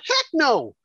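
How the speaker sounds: high-pitched, indignant rejection; emphatic refusal. Real, not acted. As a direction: knock the idea down, pitch leaping up. Answers own rhetorical question, heated.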